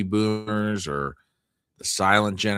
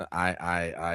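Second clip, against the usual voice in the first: ordinary talk, nothing marked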